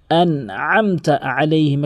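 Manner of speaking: "আনআমতা আলাইহিম" slow with two throaty ayns; careful throaty ayn. Shown as a demonstration. Teacher introducing the verse, careful throaty recitation. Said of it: "আইনের উচ্চারণগুলা".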